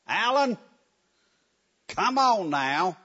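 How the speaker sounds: mock impatient parent calling out; lightly voiced parent, high then drawn out. Parent calling child third time.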